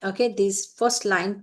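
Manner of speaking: normal talking voice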